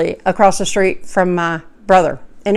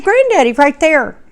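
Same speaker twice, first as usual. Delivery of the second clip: put-on child pointing; 7 semitones higher than usual.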